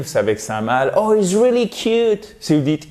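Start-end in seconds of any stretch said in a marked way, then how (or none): 1.0-2.4 high, cooing exclamation; gushing over a dog. Acted. Pointing out the neighbour's dog, high gushing. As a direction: gush in a high voice at a cute pet. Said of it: "ah, voyez le chien de la voisine".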